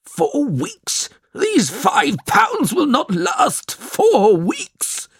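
put-on an old man shouting down a young visitor; drawn-out shout of disbelief; angry shout. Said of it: "shouted Mister Bray".

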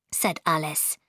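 usual speaking voice

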